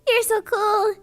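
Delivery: high, gushing thank-you. Real, not acted. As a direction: gush over a fan's gift. Thanking chatter for super.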